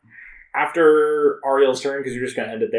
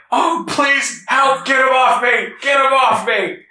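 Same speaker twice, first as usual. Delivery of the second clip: panicked victim; 5 dB louder than usual. Acted. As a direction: plead loudly like someone pinned underneath. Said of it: "is just going like".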